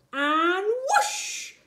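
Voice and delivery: high, loud swooping whoosh; thrilled takeoff call; excited whoosh. Acted. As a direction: launch the broom with a big whoosh. Broom takes off.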